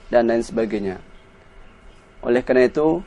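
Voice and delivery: ordinary delivery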